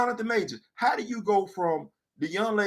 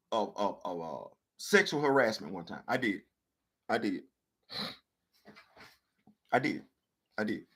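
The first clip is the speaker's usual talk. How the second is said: sheepish confession; 10 semitones lower, 9 dB quieter than usual. Acted. Low mumbled confession.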